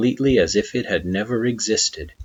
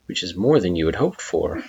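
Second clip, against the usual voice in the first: usual speaking voice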